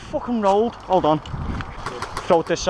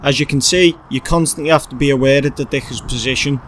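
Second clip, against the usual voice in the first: vlog narration; 7 semitones lower, 4 dB louder than usual. A narrator's reading. Voiceover explaining gameplay.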